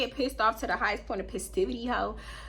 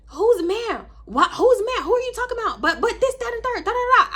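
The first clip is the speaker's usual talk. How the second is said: high, loud shrill imitation of shouting; hammed-up faraway screaming; mock yelling; 12 semitones higher, 4 dB louder than usual. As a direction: mimic a distant yelling girl, much higher. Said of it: "I just hear yelling and screaming".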